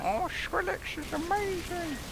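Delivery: put-on very high, squealing little-kid excitement; gushing kid fan. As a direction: gush like a starstruck young fan. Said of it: "I'm not a little kid".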